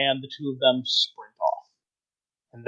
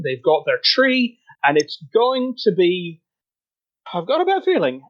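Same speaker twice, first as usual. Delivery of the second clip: higher-pitched, anxious fretting in character; nervous worrier; 6 semitones higher than usual. Acted. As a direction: play a jittery creature dreading the worst. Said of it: "just kind of nervously looking about".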